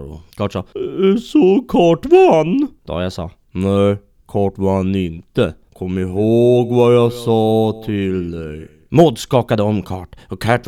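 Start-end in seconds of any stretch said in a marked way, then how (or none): 0.6-2.7 very high, loud, slow squeaky question; squeaky Kurt. Acted. Kurt hoping he won, high and drawn out. 3.5-8.7 slow, deliberate ghost voice, a little louder; slow stern ghost. Acted. Ghost Dahi correcting Kurt, slow and stern.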